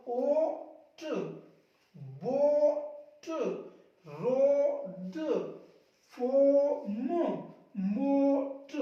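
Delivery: long loud vowel, separate final consonant; sounded-out reading. Shown as a demonstration. Teacher modelling silent-letter words, slowly sounded out. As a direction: draw each word out and detach its ending. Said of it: "इसको पढ़ो".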